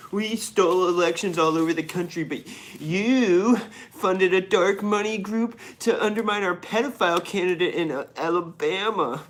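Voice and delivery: high, loud mock-indignant Republican; crybaby Republican. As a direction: whine loudly like an aggrieved politician. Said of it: "Republicans were such crybabies".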